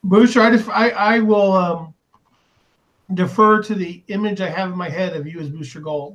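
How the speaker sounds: ordinary delivery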